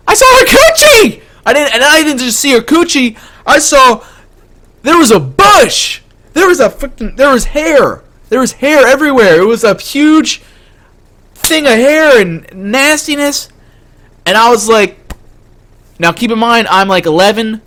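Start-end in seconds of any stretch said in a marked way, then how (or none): 0.1-1.2 very high, loud comic scream; shrieked reveal. Retold or imitated. Screams the punchline over again. 1.5-13.5 high-pitched, worked-up disgusted rant; horrified ranting. Retold or imitated. Horrified recounting of the sight.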